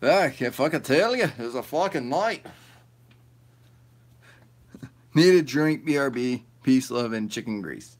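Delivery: usual speaking voice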